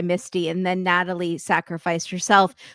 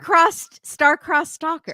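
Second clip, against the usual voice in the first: mock dramatic title; 7 semitones higher, 4 dB louder, slower than usual.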